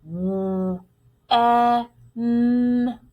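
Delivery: "w, e, n" as separate held phonics sounds; sounded out. Shown as a demonstration. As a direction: segment "when" into isolated sounds. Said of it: "We hear".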